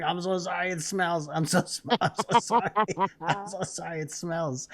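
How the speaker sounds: high, pitiful mock apology; whiny apologizing. Husband apologizing to wife for the smell, mock pitiful.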